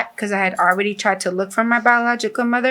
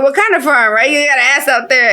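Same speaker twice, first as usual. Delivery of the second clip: louder, higher, insistent question; retold eager questioning; 5 semitones higher, 5 dB louder than usual. Storyteller quizzing her cousin about the farm, eager and loud. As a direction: jump on it, raised voice.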